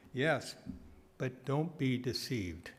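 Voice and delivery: ordinary delivery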